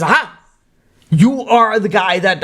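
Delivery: mock policeman catching a culprit; accusing. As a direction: pounce on the suspect, drawing out the words. Policeman accuses man he found.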